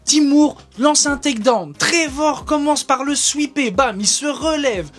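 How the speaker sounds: high, quick fight commentary; excited play-by-play. Acted. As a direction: call the action as it happens.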